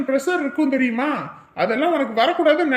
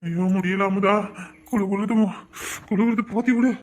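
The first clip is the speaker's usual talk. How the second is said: shivering with fever; 4 semitones lower than usual. Acted. Feverish husband asking wife to cover him, weak moan.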